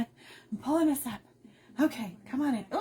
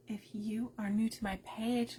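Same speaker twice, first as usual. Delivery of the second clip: ordinary delivery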